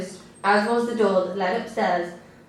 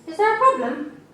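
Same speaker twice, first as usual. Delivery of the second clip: social worker voice; 9 semitones higher than usual. A narrator's reading.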